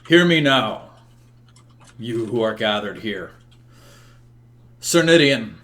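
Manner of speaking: loud opening, formal royal address; commanding ruler, pausing between phrases; proclaiming king. Acted. Game master voices King Uther addressing hall.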